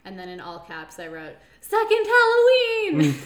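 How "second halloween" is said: loud, high, slow shout. Acted. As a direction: yell it in all caps. Reading own all-caps note.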